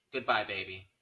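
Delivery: usual speaking voice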